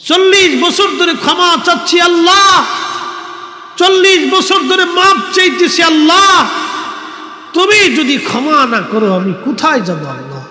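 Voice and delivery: high, shouted cry to Allah; impassioned wailing supplication; desperate plea. Retold or imitated. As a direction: beg Allah at full pitch in a preacher's chant.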